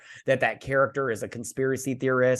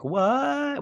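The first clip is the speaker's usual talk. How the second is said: very high, stretched-out "What?"; shocked disbelief; 13 semitones higher than usual. Acted. Audience reacting to villain's boast, high mock-shocked cry.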